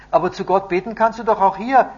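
put-on high, loud childlike objection; protesting boy. A narrator's reading. Boy objects to his sad grandfather, high and insistent. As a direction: pipe up in eager protest.

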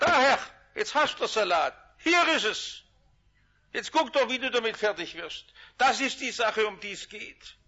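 a fed-up servant dumping the problem on his master; Hezekiah handing over the letter. Retold or imitated. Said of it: "hat gesagt".

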